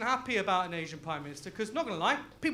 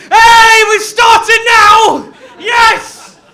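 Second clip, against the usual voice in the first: loud, high, laughing cheer; excited shouting; 11 semitones higher, 21 dB louder, much slower than usual. Real, not acted. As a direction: celebrate the crowd finally joining in. Cheers crowd joining in.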